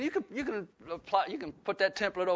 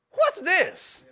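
higher, mock-puzzled question; surprised; 12 semitones higher, 5 dB louder than usual. Pastor recalling his knee giving out, mock baffled. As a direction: act baffled by your own knee. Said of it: "it'd be different if I stepped on something".

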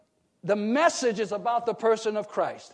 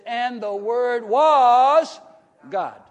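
slow and loud, stress on "was"; emphatic; 7 dB louder, much slower than usual. Shown as a demonstration. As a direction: lean hard on "was", then drop the last word "God.". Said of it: "and it emphatically says".

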